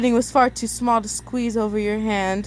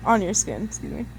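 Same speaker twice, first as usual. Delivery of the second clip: plain reading voice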